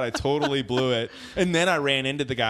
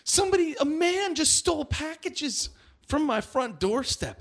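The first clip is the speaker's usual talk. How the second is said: much higher, imitating a panicked lady crying for help; retelling a neighbour's cry for help, in a high mock panic; mock frantic scream. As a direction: play the neighbour yelling for help, pitched way up.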